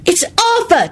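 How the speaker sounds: high, stubborn call; stubborn holler. A narrator's reading. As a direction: holler it insisting. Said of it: "hollered stubbornly".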